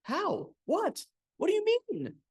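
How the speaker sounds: high, flustered questions; flustered, high pitched disbelief; high confused surprise. A narrator's reading. The woman, baffled by the angry bucket, asks high and flustered.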